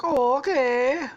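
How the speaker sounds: high, drawn-out fake Italian reply; mock Italian accent. Squishy answering Stampy, slow high mock Italian accent. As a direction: stretch it out in a high cartoon Italian voice. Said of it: "Squishy's gonna be Italian too".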